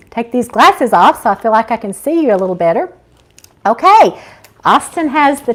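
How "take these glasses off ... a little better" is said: usual speaking voice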